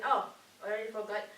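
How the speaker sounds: ordinary delivery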